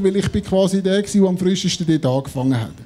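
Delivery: normal talking voice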